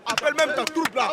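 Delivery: very high, loud yelling; furious shout. Acted. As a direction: scream the insults. Insulting the officer.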